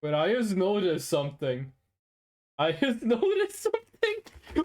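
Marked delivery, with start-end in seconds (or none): 2.6-4.6 laughing it out, much higher; amused disbelief breaking into a laugh. Real, not acted. Realizes he got hustled, laughing.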